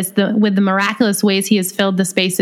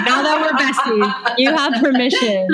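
laughing; 5 semitones higher than usual. Real, not acted. Laughing with new bestie.

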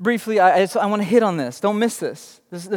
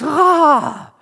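sound effect; 8 semitones higher, 6 dB louder than usual. Retold or imitated. Imitating hiss of steam release.

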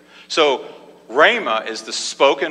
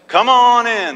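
high, loud preacher's call; big, ringing welcome shout; calling out invitation; 8 semitones higher, 6 dB louder than usual. Acted. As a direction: call the crowd in from afar. Calls all comers in.

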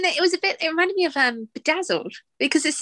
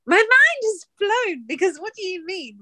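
mind-blown disbelief; 8 semitones higher than usual. Retold or imitated. Imagined viewer hearing about Elizabeth Hurley, mind-blown disbelief. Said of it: "I'd be like".

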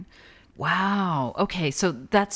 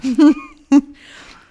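loud laugh; 10 semitones higher, 14 dB louder than usual. Real, not acted. Laughs about her pocha.

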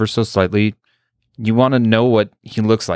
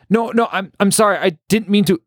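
flustered Heather; 12 semitones higher, faster than usual. A narrator's reading.

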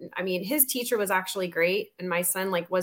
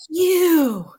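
sharp high accusing "You."; mock-point at her with a high loud "You."; imitating furious stranger; 7 semitones higher, 8 dB louder than usual.

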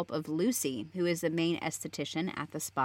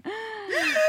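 high, hammed-up shocked gasp; dramatic gasp. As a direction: gasp as if scandalized. Shocked at John's cheating.

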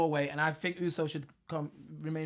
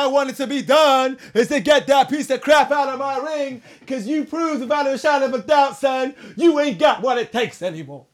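angry shout; 9 semitones higher, 14 dB louder than usual. Retold or imitated. Mimicking a wrestler's promo rant.

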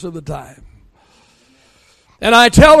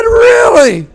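much higher, a hammed-up yelp of "Really?"; mock shock; 12 semitones higher, 5 dB louder than usual. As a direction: act wounded and astonished. Grandpa's feigned shock at tease.

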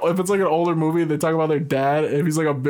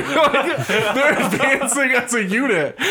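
laughing; 7 semitones higher, slower than usual. Real, not acted. Laughing through the story.

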